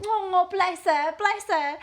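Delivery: slow, high pitying "bless her"; a hammed-up, sorry-for-her coo; mock pity. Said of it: "I got a bit of a sympathy for her".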